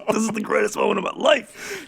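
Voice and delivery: high, held-in squeal of delight; trying to contain glee. Retold or imitated. Pranksters watching pilot find comic, high-pitched contained glee. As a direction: squeeze out the joy at a high pitch. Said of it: "Just, like, trying to hold it in".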